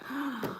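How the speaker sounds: a quick soft breath in; a hushed surprised gasp. Real, not acted. Reacts to the Detroit comment.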